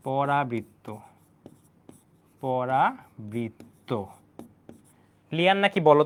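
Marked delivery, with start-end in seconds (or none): 0.1-3.9 very slow, stretched syllables; drawn-out dictation. Real, not acted. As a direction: say it slowly as you write it out. Saying the term while writing it.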